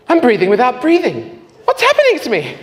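loud, high-pitched astonishment; bewildered mock outcry. New convert to the praying woman, high bewildered cry. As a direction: cry out in comic disbelief.

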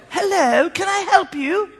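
lightly imitated posh English accent on the phone; a hint of posh English telephone manners. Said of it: "She goes really posh".

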